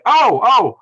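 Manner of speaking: loud, high double exclamation; sudden realization. Real, not acted. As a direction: burst out as you spot your mistake. Realizes his screen mistake.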